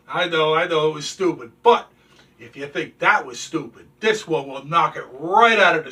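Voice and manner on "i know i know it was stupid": normal talking voice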